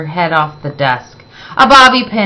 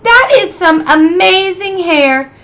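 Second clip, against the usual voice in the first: high, awed praise; sincerely impressed; admiring wonder; 10 semitones higher than usual. Acted. Teacher admires hair.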